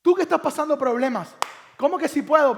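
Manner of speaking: loud, high preaching build; fervent preaching. Acted. Preacher calling out to the congregation, loud and fervent. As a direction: raise the voice and plead with the congregation.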